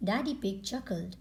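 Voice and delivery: ordinary delivery, nothing marked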